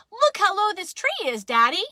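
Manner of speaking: excited high, loud call. Acted. Child calls back to Daddy about the tree, calling out. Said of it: "I called back to Daddy".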